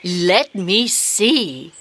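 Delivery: slow thinking. Acted. Teacher pausing to look.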